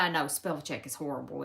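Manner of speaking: normal talking voice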